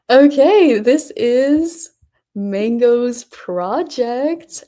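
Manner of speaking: loud, high excited announcement. Real, not acted. Streamer opening a viewer's project, excited. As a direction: open big and high, drawing it out.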